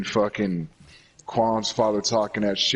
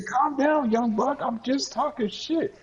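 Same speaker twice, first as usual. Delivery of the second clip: put-on older man teasing. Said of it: "he said".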